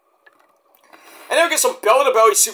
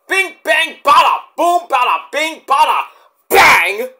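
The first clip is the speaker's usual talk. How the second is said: high, loud bing-bang-boom; excited shouted hype; 9 semitones higher, 6 dB louder than usual. Real, not acted. Telling viewers how fast Tony D won, loud excited hype. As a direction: belt it out like a ring announcer, pitched up. Hyping the quick pinfall finish.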